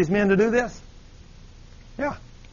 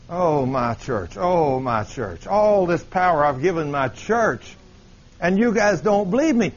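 slow, drawn-out disappointed sighing words; dismayed lament as Jesus; slower than usual. Acted. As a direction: lament slowly over a failing church. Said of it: "What do you think he probably says to us today?".

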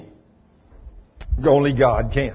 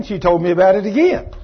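normal talking voice

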